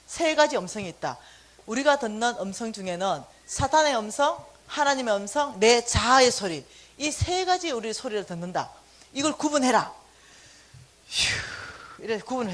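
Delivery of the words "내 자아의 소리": ordinary delivery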